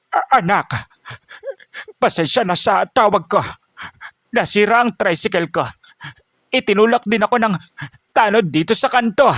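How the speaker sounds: put-on understated grief of an old father on the phone; sad soft voice. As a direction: tell your son what happened, holding back hurt. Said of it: "Sa mahinang boses, ngunit puno ng lungkot".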